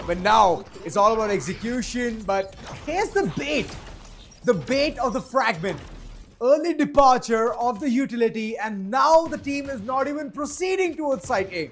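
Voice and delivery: hyped caster tracking the action; excited play-by-play. Real, not acted. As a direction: call the opening moves with rising urgency. Live play-by-play of round.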